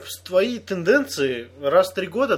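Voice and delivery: normal talking voice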